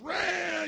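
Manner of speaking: high, drawn-out comic "rawr"; mock angry roar. Husband mimics his riled-up wife, mock roar. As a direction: imitate her going off in a growl. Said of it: "and she's just".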